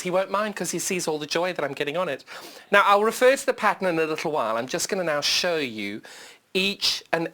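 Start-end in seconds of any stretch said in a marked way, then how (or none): none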